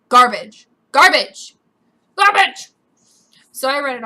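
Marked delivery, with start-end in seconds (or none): 0.1-1.5 loud, punched single word; disgusted, forceful repeats; emphatic condemnation. Real, not acted. Repeats the insult with growing force. 2.2-2.7 high, loud yell; exploding in disgust; emphatic outburst. Real, not acted. Final, highest repeat of the insult.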